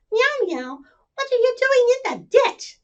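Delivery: high, curious kitten voice; a tiny kitten peering in; little kitten voice. Acted. Kitten asking the stuck animals, high and curious.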